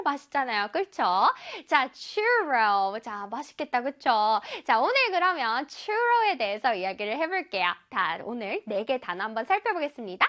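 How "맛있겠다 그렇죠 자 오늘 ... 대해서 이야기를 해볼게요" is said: ordinary talk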